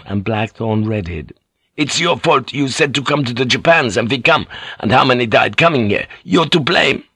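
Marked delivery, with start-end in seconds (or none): 1.8-7.0 put-on accusing sailor with a foreign accent; heated accented accusation, pitch jumping up; Fink. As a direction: pin the blame on him hard. Fink blames the pilot.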